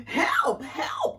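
high, loud cry for help. Acted. Rabbit fleeing the loud voice, high alarmed cry. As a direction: yelp it in story-time alarm. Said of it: "cried Rabbit".

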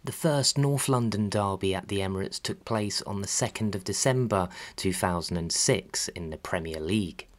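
ordinary delivery

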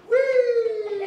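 high delighted squeal; a little snail's joyful high whee; gleeful whee. Acted. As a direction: squeal like a kid on a ride. Snail riding on turtle's back.

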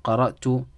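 قرأت said "qara'tu", ending in "u"; rejected "tu" reading. Shown as a demonstration. Teacher rules out a reading, "qara'tu" for قرأت. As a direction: close قرأت on "-tu". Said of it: "There's four ways of reading this word".

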